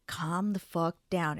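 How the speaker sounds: slowed, whispered self-calming; slow whisper to herself. Retold or imitated. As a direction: breathe the words out slowly in a whisper. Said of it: "this is how I had to talk to myself".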